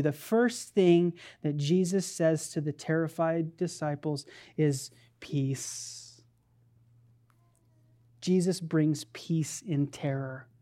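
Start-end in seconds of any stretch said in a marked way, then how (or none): 5.2-6.2 soft, low, whispered word; hushed whisper. Retold or imitated. Preacher giving Jesus' first word to terrified disciples, whispered. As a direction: let it out as a gentle hush.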